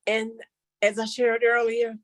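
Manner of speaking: normal talking voice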